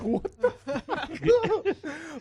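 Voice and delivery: high, loud drawn-out disbelief; slow, incredulous high voice through laughter; baffled, laughing. Acted. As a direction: crack up while voicing bewildered guards. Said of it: "They're just looking at each other like".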